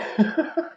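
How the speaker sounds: short high burst of laughter. Real, not acted. Storyteller to viewers, giggling at the snake oil stamp. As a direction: giggle at the scam brand.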